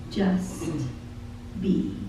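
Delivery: softly breathed out; hushed. Acted. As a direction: hush it like a gentle calling. Soft refrain in lyric.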